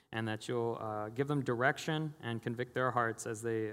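hushed pulpit prayer. Real, not acted. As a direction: lead the congregation in a subdued, earnest prayer. Leads a prayer.

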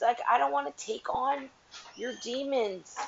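ordinary delivery

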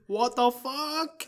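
high, drawn-out disbelief; incredulous outburst. Acted. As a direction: stretch each word out high, scandalised.

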